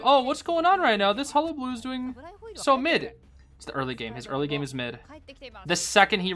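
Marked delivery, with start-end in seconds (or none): 0.0-3.1 mock viewer. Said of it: "you're probably like".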